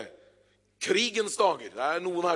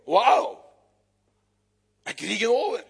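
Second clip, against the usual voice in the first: 1 amazed realization; 5 semitones higher, 6 dB louder than usual. Acted. Newsboy realizes the news.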